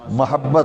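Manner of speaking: first syllable of محبت with a short a; short a (zabar) on the meem of محبت; correct vowel. Shown as a demonstration. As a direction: say محبت as "mahabbat". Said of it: "یہ میم پہ زبر ہے".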